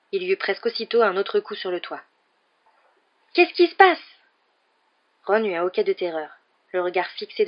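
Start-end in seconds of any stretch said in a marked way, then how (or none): 3.3-4.0 high, loud, panicked question; alarmed cry. A narrator's reading. Boy in a car struck by blows, alarmed. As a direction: call out in alarm.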